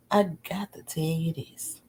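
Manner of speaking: hushed, secret whisper; whispered aside to the viewers. Real, not acted. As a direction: lean in and whisper it.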